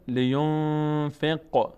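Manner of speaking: فق held slow with the ق echoing; vibrating qaf. Shown as a demonstration. Teacher shows the bouncing qaf in فق. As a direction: let the ق in فق ring out slowly.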